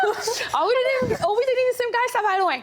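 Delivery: high laughing voice, breaking through the words. Real, not acted. Guest laughing while telling the host about the dating page, amused. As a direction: laugh as you say the page's name.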